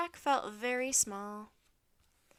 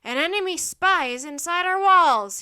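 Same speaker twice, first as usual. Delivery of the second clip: high, very loud alarm to troops; roared; 6 semitones higher, 16 dB louder than usual. A narrator's reading. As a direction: roar it to the horsemen. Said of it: "he roared".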